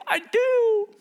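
squeaky hammed-up "I do"; eager couple. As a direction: imitate grooms' eager vows. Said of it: "They go".

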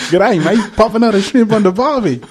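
put-on high, loud goofy Australian accent; hammed-up mock Aussie film quote; Lloyd Christmas. Co-host quotes Dumb and Dumber, high hammed-up Australian. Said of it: "then he's going to Austra".

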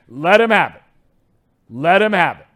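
loud, punched insistence; yelling. Real, not acted. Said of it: "wants to know who I'm yelling at".

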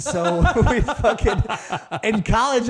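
cracking up as he starts the story; laughing. Real, not acted. Laughing while starting his story.

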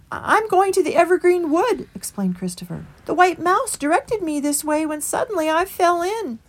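meek small creature pleading for the way; Christopher. A narrator's reading. Christopher explains.

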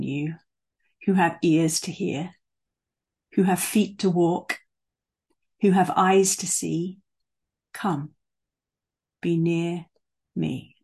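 unhurried song lyrics read with long pauses; really slow reading. Real, not acted. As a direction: let each line sink in as an invitation. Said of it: "I'm gonna read them really slowly".

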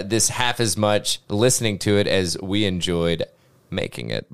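usual speaking voice

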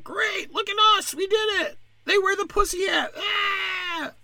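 very high, squealing mock cheer; piercingly high-pitched gloating; mock celebrating women. Mocks women celebrating abroad.

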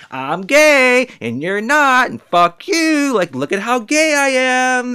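high, loud, slow camp caricature voice. Retold or imitated. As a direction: sneer it out as a taunting caricature. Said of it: "He's like".

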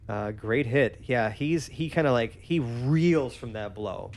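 ordinary delivery

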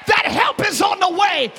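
high, shouted preaching; full-force preaching. Real, not acted. Preacher urging the church to rejoice before rescue, full-force preaching. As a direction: shout it out to fire up the congregation.

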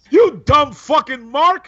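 loud, high, drawn-out mock insult; sound-effect stand-in. Shown as a demonstration. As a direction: belt it out slowly like a sound clip. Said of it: "can you go ahead and do that?".

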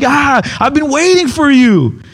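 as if he expected you all along; a lightly voiced patient king; throne man's welcome. Man on throne greets dreamer.

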